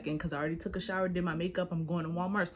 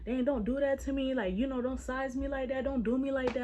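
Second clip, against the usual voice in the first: higher-pitched mock pleading; retold protest; 6 semitones higher than usual. He pleads not to be sized up, mock protest. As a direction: act out his protest, pitched up.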